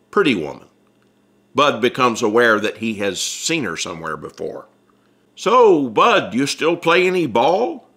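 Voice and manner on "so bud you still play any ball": a louder, higher friendly prod; bright question to an old catcher; Ripley asking. Acted. Ripley asks his old catcher if he still plays, louder.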